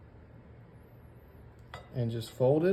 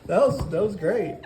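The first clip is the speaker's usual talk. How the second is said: higher, louder words through laughter; laughing praise; 7 semitones higher, 6 dB louder than usual. Real, not acted. Cook praises the guest's German accent, laughing. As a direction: praise it while cracking up.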